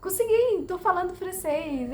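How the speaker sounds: high, bright burst of triumph; excited exclamation. Retold or imitated. Learner telling viewers her breakthrough moment, joyful outburst. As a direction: shout it like you finally made it. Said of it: "que eu consegui e falei".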